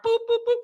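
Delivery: high-pitched "boop, boop, boop"; boop noises. Shown as a demonstration. Host miming typing on a computer, high playful boops. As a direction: imitate little button beeps with high boops. Imitates computer beeps while giving contacts.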